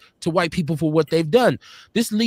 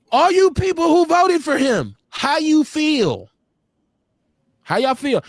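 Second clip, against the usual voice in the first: taunting; 7 semitones higher, slower than usual. Real, not acted. Taunting Trump voters.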